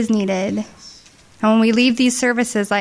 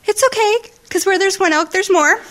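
much higher, squeaky and loud; high emotional squeal; 11 semitones higher, 4 dB louder than usual. Retold or imitated. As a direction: squeal it out, overcome. Mother's emotional reaction retold.

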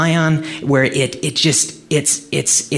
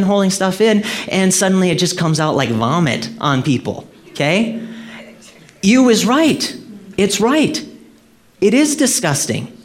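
fervent preaching; 7 semitones higher than usual. Acted. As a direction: rouse the congregation. Preaches at full force.

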